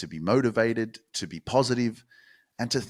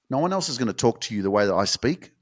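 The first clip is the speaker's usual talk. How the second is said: normal talking voice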